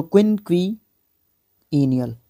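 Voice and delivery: क्विनक्विनियल stretched out slowly, each syllable clear; slow careful syllables. Shown as a demonstration. Teacher re-pronouncing क्विनक्विनियल carefully after admitting mistakes, slow. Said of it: "confess कर रहा हूँ आपके सामने".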